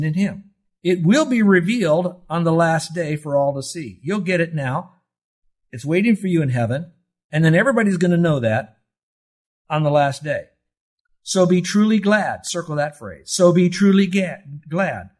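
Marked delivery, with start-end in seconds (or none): 0.8-12.5 fervent sermon climax; preaching at full force. Real, not acted. 13.3-15.1 loud, higher, slow emphatic preaching; fervent sermon climax; preaching at full force. Real, not acted.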